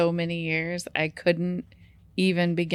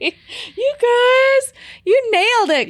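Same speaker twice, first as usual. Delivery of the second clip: excited; 10 dB louder than usual. Real, not acted. Cheering each other.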